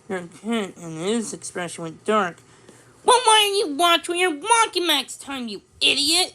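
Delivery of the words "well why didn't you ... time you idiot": high, loud angry retort; yelling back. A narrator's reading. Shopkeeper yelling back at Owen, furious. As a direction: shout back the insult. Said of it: "he yelled back".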